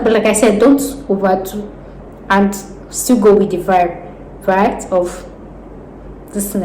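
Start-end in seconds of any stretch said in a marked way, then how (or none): none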